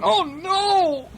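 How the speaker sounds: high-pitched acted wail; scared cry. A narrator's reading. Toy clown carried off by the train, scared high cry. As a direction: cry out high in mock fright. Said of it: "He's scared".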